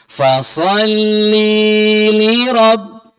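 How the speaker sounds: loud chanted "li" held too long; wrong long li. Shown as a demonstration. Teacher demonstrating a still-wrong reading, loud. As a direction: belt out the mistaken long "li". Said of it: "padahal masih keliru".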